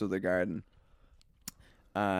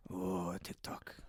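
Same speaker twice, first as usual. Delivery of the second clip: whispered disdain; 4 semitones lower, 15 dB quieter, much slower than usual. Retold or imitated. Boss reacts to TikTok.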